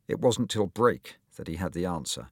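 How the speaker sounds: ordinary delivery, nothing marked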